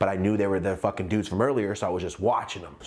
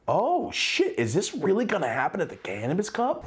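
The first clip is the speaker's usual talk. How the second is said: oh shit; 7 semitones higher than usual. Retold or imitated. Alarmed inner thought, fight looming.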